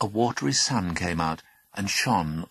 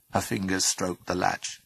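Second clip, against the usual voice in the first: plain reading voice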